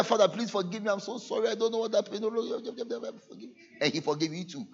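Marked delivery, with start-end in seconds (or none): 0.0-3.6 mock pleading. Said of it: "The same way he said".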